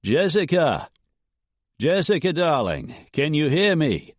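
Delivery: shouted call. A narrator's reading. Dietrich shouting to Jessica in the canyon, calling. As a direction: call out to her across the dark. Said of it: "the man with the Boston accent shouted".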